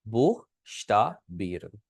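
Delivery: slow, syllable by syllable, श्ता starting with sh; sh sound in श्ता. Shown as a demonstration. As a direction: break it into बुख, श्ता, बीरन with pauses. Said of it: "शे की sound करेगा".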